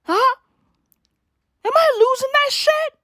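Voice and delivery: high, squeaky mock-alarmed question; mock panic. Real, not acted. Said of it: "Am I losing my ability to talk".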